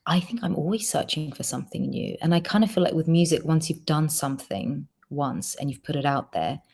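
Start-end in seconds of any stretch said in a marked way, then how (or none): none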